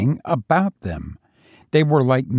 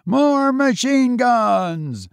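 yelling; 11 semitones higher, slower than usual. A narrator's reading. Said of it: "Bill yelled to Tom".

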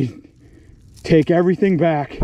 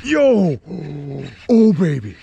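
excited yell; 2 semitones higher, 3 dB louder than usual. Real, not acted. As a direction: shout it as the fish hits. Fish suddenly strikes the line.

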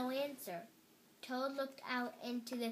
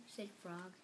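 ordinary delivery, nothing marked

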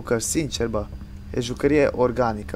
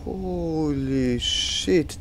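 stunned; 3 semitones higher, 5 dB quieter, much slower than usual. Real, not acted. Awed after chase.

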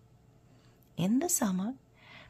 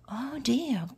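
higher-pitched storybook dismay; a touch of distress, pitched up; dismayed cry; 6 semitones higher than usual. A narrator's reading. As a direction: cry out in mild alarm. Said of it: "cried Amelia".

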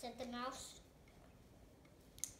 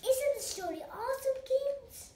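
high, loud eager question to viewers; excited; 9 semitones higher, 7 dB louder than usual. Real, not acted. Child addresses viewers excitedly.

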